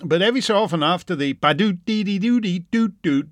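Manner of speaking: ordinary talk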